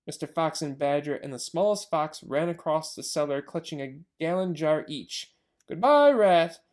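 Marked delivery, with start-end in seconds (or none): 5.7-6.6 calling out. Acted. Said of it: "they called out".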